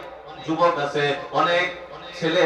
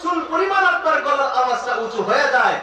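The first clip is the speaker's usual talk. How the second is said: preaching shout; 10 semitones higher than usual. Acted. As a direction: lift the voice sharply to show it.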